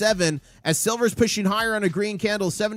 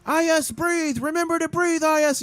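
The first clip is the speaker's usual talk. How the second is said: squeaky calming voice; 10 semitones higher than usual. Retold or imitated. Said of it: "I was like".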